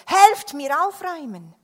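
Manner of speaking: loud, high urgent shout; pressing storybook holler; calling for help. A narrator's reading. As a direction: call out to anyone who can hear. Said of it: "rief er".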